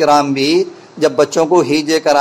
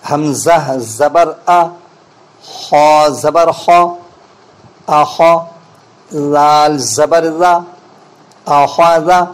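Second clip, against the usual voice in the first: careful correct spelling; slower than usual. Shown as a demonstration. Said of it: "اس کو غور سے سنیں".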